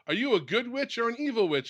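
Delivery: lightly voiced children asking; curious kids. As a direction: play the kids questioning the witch. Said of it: "some kids go".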